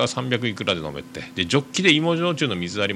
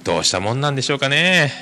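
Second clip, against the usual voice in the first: ordinary talk, nothing marked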